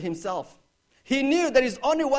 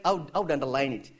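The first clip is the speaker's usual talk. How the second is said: ordinary talk, nothing marked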